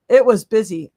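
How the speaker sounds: usual speaking voice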